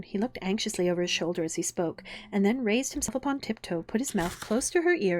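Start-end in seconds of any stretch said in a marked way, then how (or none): none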